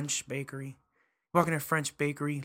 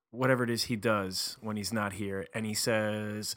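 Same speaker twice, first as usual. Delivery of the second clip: ordinary talk, nothing marked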